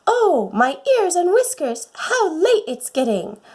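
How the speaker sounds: a flustered little rabbit wailing that it is late. Acted.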